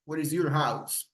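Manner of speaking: usual speaking voice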